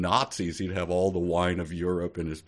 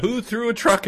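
much higher squeaky bewildered voice; high, louder mock-confused question; mock bewildered girl; 5 dB louder than usual. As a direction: play her dazed and high-pitched.

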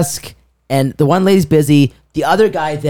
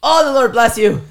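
high, effusive blessing; joyous; 11 semitones higher than usual. Retold or imitated. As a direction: imitate an African man overflowing with gratitude. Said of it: "most joyous look on his face".

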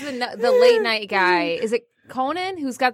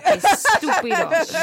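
mock Italian grandma; 6 semitones higher, slower than usual. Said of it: "As Nonna would say".

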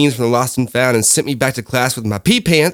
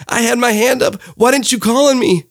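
put-on high, pleading child voice; mock teary kid protest; crying child; 9 semitones higher than usual. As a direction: whine it up high like a hurt first grader. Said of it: "I looked at my teacher crying, and I said".

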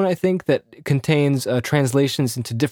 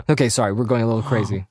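ordinary talk, nothing marked

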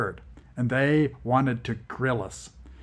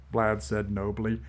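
ordinary delivery, nothing marked

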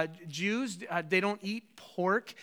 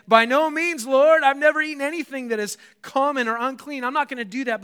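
higher and louder, a startled refusal; alarmed protest; 5 semitones higher, 8 dB louder than usual. Acted. Peter refusing the Lord's command, loud and high. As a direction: push back like a shocked Peter.

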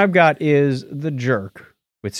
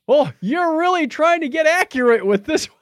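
much higher, put-on comic voice; movie character quote. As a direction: play the line as the flustered hero. Quoting Steve Martin's line.

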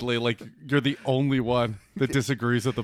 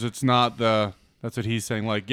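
ordinary delivery

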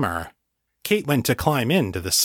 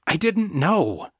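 higher, slow, defensive insistence; insistent; 9 semitones higher, slower than usual. A narrator's reading. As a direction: insist you didn't know. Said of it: "John insisted".

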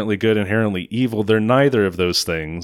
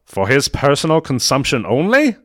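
higher, louder, playfully shocked question; mock shock; 7 semitones higher, 4 dB louder than usual. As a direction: ham up a scandalized gasp of a question. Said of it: "Like, he's kind of shocked".

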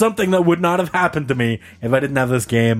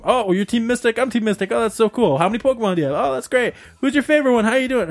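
higher-pitched, chatty imitation; chummy small talk; 8 semitones higher, faster than usual. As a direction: reenact eager fans getting acquainted. Acts out excited Pokemon small talk.

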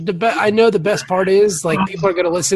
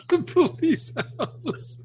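laughing; 8 semitones higher, 11 dB quieter, much slower than usual. Real, not acted. After laughing hard.

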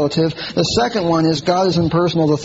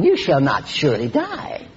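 Satan's lie; 3 semitones lower than usual. Retold or imitated. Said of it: "Satan said that to Eve".

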